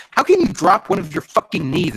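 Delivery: high, loud exasperation; incredulous complaint. Real, not acted. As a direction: demand an answer in disbelief. Reader mocks the author's wording.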